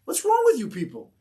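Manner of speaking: high, heated scolding; angry yelling. Retold or imitated. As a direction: act out chewing out the whole army. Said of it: "Starts yelling at them".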